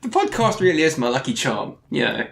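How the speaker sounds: ordinary talk, nothing marked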